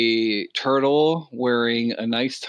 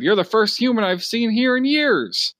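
a lightly voiced, higher Steve Buscemi; 10 semitones higher, 3 dB louder than usual. GM voicing a trapped Steve Buscemi to the player, high. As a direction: pitch it up as the trapped actor.

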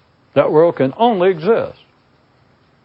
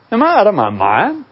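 acted disbelief, pitched up; incredulous self-questioning; 6 semitones higher than usual. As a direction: question your own sanity in a higher voice. Acts out catching himself getting angry.

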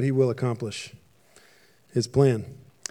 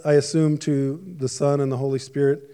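ordinary delivery

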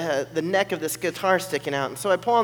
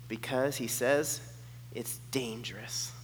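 slow and much softer, like a secret; hushed ominous reveal; 6 semitones lower, 13 dB quieter, slower than usual. Retold or imitated. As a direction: lower the voice for the warning. Translator whispers ominous news.

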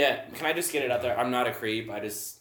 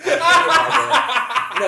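loud, high, slow laughing declaration; laughing outburst; 12 semitones higher, 12 dB louder, much slower than usual. Real, not acted. Telling friends about a drunk German woman, laughing loud. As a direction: blurt it out through big laughter.

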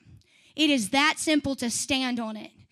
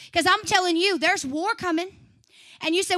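ordinary talk, nothing marked

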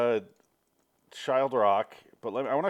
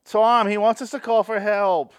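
high, loud, slow-witted voice; hammed-up dim character; 9 semitones higher, 7 dB louder than usual. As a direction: play a dopey sidekick. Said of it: "They do a whole bit".

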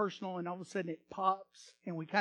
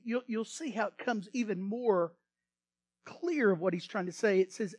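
normal talking voice

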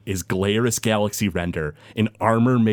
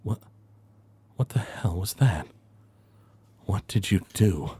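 hushed stunned villain, slowing down; a hint of a shaken cult leader; 3 semitones lower than usual. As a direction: let the shock sink in. Villain reacts to party's attack.

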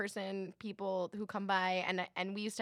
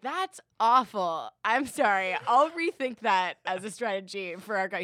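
mock contrite apology; 7 dB louder than usual. Parent apologizing to child.

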